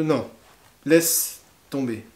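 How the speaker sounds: ordinary talk, nothing marked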